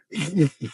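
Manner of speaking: imitating a wheezy cartoon-dog snicker, high; mock Muttley cackle; a hammed-up wheezing dog laugh; Muttley wheeze laugh. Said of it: "he sounded like this dog named Muttley".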